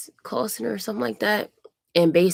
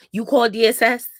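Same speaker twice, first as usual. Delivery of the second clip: louder, higher imitation of a rude caseworker; nasty attitude; 5 semitones higher, 6 dB louder than usual. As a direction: throw the question out with mocking nastiness. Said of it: "her attitude was nasty".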